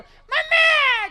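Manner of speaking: very high, long-drawn yell; hammed-up stretched wail; mock cry for mummy. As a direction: scream it like a fallen child. Fallen child calls for mother, acted.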